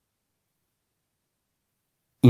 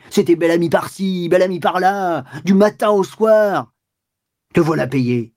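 furious accusation; 6 semitones higher than usual. Acted. Walter blaming his wife for the scandal, furious and high. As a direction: rage at the wife, pitch climbing. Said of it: "avec rage".

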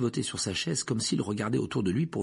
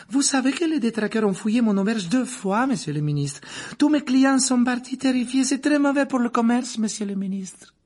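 barmaid voice; 13 semitones higher, 5 dB louder than usual. A narrator's reading. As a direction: lift the voice high and serve the drinks sweetly. Rosmerta complains to minister.

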